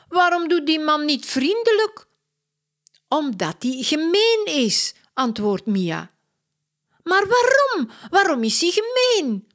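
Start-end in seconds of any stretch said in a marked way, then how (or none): 0.1-4.9 put-on high, louder little girl; child voice. Two sisters discuss the mean man on TV, childlike. 7.0-9.5 much higher, louder whiny question; insistent child. Acted. Katja keeps asking her sister why, pleading.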